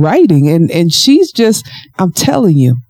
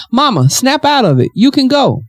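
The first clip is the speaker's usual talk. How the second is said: put-on high-pitched kid nudging Mama; high, impatient storybook kid; child's voice; 7 semitones higher than usual. A narrator's reading. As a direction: prod Mom in a high child's voice. Child prods daydreaming mother in story.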